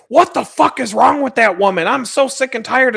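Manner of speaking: retold exasperated rant; mock ranting. Buddy going off about the woman in the clip, mock exasperation. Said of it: "going off".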